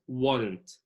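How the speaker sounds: American why don't squeezed into warrant; American reduced form. Shown as a demonstration. Teacher drilling American linking, why don't said like warrant. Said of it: "باللهجة الأمريكية الخالصة".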